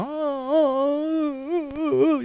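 high, soft, long whining howl; drawn-out mock dog crying; imitated dog howl. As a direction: whimper like a dog crying on the porch. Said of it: "el perro que estaba en el portal llorando".

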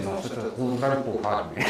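hushed and slow, barely moving the lips; very quiet. Retold or imitated. Pleading under his breath to the praying doctor, very quiet. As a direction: mutter it low so nobody hears. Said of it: "le estaba hablando así, bien bajito".

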